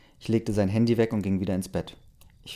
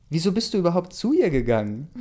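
high-pitched indignant question; agitated accusing question; upset confrontation; 8 semitones higher, 4 dB louder than usual. A narrator's reading. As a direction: demand an explanation, hurt and cross. Acted confrontation of cheating partner.

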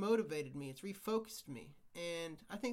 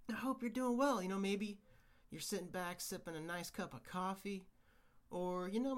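usual speaking voice

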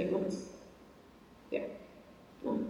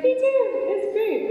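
high-pitched, louder chirp of agreement; mock-enthusiastic friend, pitched way up; imitated eager agreement; 14 semitones higher, 3 dB louder, slower than usual. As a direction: play the friend happily chiming in.

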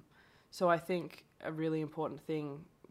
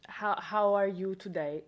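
slow, louder Brazilian-accented greeting; demonstrated Brazilian English; 3 semitones higher, 5 dB louder, slower than usual. Shown as a demonstration. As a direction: put on a Brazilian accent, drawing it out. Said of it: "I'll put on the accent".

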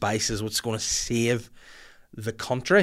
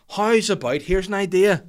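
ordinary talk, nothing marked

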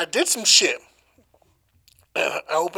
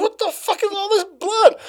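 high, startled retold cry; alarmed shout. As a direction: yelp it in disbelief. Retelling horror at blood.

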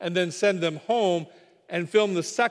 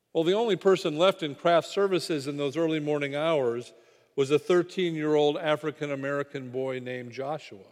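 ordinary talk, nothing marked

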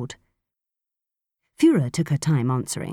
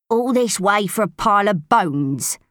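grumbling complaint, a bit louder; complaining; 5 semitones higher, 3 dB louder than usual. Acted. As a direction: whine it like a tired old gripe. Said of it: "in a familiar, complaining tone".

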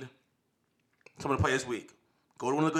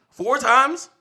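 high, loud disbelief; incredulous question; 10 semitones higher, 6 dB louder than usual. Real, not acted. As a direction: repeat it as an astonished question. Disbelief at player's four arrests.

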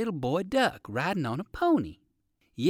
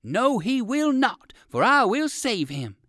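much higher, louder and slow; determined shout; 8 semitones higher, 5 dB louder than usual. A narrator's reading. Old rabbit shouting that he will save Jimmy, louder. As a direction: call out boldly to rescue. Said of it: "shouted Uncle Wiggily".

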